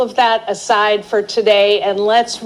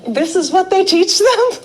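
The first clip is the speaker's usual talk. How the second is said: incredulous; 8 semitones higher than usual. Retold or imitated. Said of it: "And I was like".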